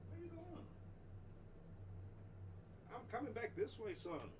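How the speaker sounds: high, soft pet talk; talking to her pet. Real, not acted. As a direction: coo at the dog. Talks to her pet Chunky.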